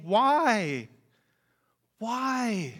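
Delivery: high, pleading cry of why; anguished why. Acted. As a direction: cry out to heaven. Voicing people's anguished question.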